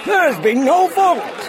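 very high, loud protest; outraged high-pitched cry. A narrator's reading. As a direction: shout an objection over the crowd. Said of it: "cried one dignitary".

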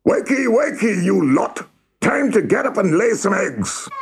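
high-pitched drill-sergeant cockerel wake-up; crowing cockerel. A narrator's reading. Head cockerel wakes the hens to lay eggs, crowing. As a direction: crow it high to rouse the sleepers. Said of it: "he crowed very loudly".

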